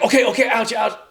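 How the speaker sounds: high, yelped "okay, okay, ouch"; a beaten-up guy giving in; hammed-up wincing movie character; mock pained surrender. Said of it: "he's like, he's like".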